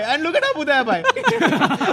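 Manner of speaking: high, loud laughing exclamation. Real, not acted. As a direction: laugh while pointing it out. Laughing at celebration video.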